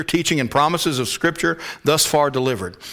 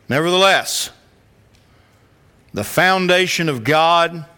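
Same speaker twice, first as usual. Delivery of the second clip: slow, raised, declamatory Bible reading; scripture reading; 4 semitones higher than usual. Real, not acted. Preacher reads verse nineteen to the church, slow and proclaiming. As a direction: proclaim the verse slowly, with weighty pauses.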